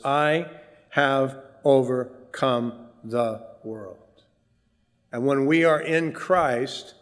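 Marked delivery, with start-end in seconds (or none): 0.0-4.0 "I" set apart, then "have overcome the world" slowly; emphatic I. Shown as a demonstration. Preacher quoting Jesus to show the emphatic pronoun, emphatic. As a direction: weigh each word after a separate, stressed "I". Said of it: "it's emphatic for emphasis".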